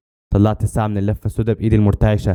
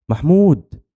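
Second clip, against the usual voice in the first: high, urgent cry of the name; screamed warning; 8 semitones higher, much slower than usual. A narrator's reading. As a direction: cry out to warn a friend. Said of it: "صرخت".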